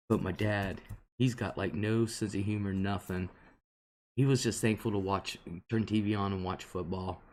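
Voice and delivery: ordinary talk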